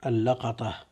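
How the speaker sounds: واللقطة as al-la-qa-ta, "a" on lam and qaf; fatha on lam, qaf. Shown as a demonstration. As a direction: say واللقطة with open la and qa. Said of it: "بفتح اللام والقاف".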